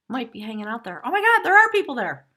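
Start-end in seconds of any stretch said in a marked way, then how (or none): 1.0-2.3 sudden loud, high gasp of delight; thrilled to find people watching; delighted surprise. Real, not acted. Sees live viewers have joined.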